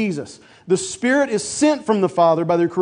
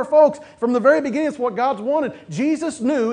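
higher, building preacher's cadence; fervent preaching; 5 semitones higher than usual. Acted. Pastor stirring the congregation, preaching at full force. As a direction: preach it with rising fire.

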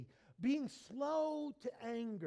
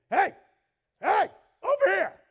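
shouted hey, hey, over here, much higher and louder; calling out loud; 9 semitones higher, 15 dB louder than usual. Retold or imitated. Preacher voicing God calling out to the lost, in a big shout. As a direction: call across a crowd to get attention.

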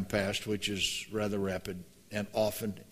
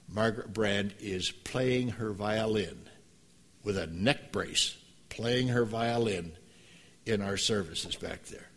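usual speaking voice

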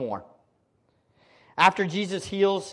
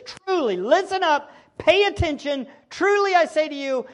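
emphatic Jesus; 10 semitones higher, 8 dB louder than usual. Retold or imitated. Jesus voiced to crowd.

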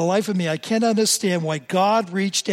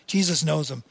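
ordinary delivery

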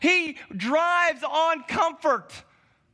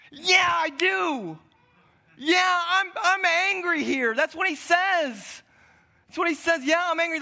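defiant Jonah; 4 semitones higher than usual. Acted. Jonah answers God defiantly.

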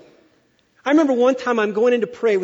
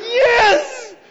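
much higher, loud shout of joy; excited yes; 14 semitones higher, 6 dB louder than usual. Retold or imitated. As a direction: burst out with jubilant yes. Enthusiastic shout.

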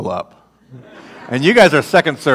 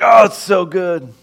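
delighted; 4 semitones higher than usual. Retold or imitated. God delighted, voiced.

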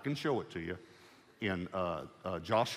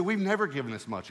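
excited; 6 semitones higher, 5 dB louder than usual. Retold or imitated. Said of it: "he was so excited".

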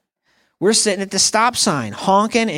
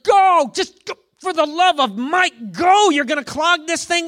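impatient honking driver; 10 semitones higher than usual. Retold or imitated. Driver yelling at stopped cars, high mock impatience. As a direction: hurry them along, exasperated. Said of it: "you're like".

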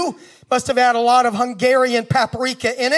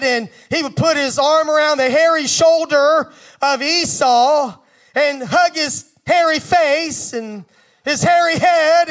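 ordinary delivery

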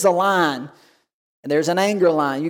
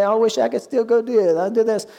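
imitated wistful grumble, pitched higher; mock complaining; 4 semitones higher than usual. Preacher imitates Christians missing old ways, mock complaining. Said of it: "And we complain".

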